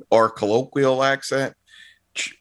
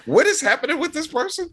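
confused. Retold or imitated. Confused reaction to the story.

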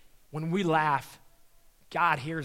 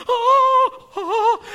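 high, loud, goofy yelps; Brian Regan; 13 dB louder than usual. Retold or imitated. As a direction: squeal in comic shock. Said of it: "he turned into Brian Regan".

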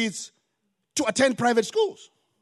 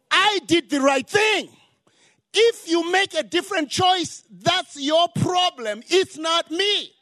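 rising from level telling to a loud shouted rebuke; stern shout to kids; 5 semitones higher, 3 dB louder than usual. Retold or imitated. Father telling his grown kids, slow loud shout. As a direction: hammer home that the choice is theirs, slow and loud. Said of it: "I tell my kids all the time".

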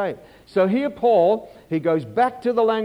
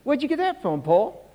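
questioning voice; 7 semitones higher than usual. Pretend. Preacher questions Paul again.

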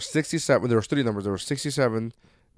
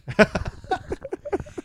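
breathy high laugh; 4 dB quieter than usual. Real, not acted. Laughing after retold quote.

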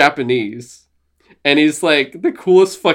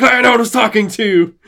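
high, loud mock outburst; hammed-up boss snapping at an underling; telling her off; 9 semitones higher, 5 dB louder than usual. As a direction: imitate an irate boss yelling. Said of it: "tells Claire to shut the fuck up".

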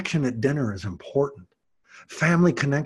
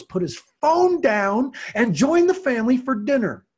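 emphatic; 10 semitones higher, 5 dB louder than usual. Real, not acted. Emphatic climax of point.

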